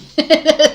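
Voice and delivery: loud laughing burst. Real, not acted. Recalling being a crybaby, loud real laugh. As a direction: laugh it off loudly.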